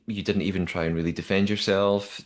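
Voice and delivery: normal talking voice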